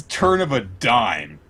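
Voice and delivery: usual speaking voice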